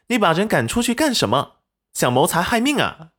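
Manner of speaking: quick, high-pitched challenge; indignant accusation. A narrator's reading. Wife scolds husband for sending the guard away, indignant.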